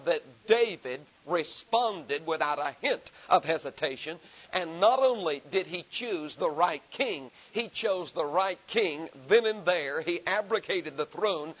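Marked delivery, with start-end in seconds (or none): none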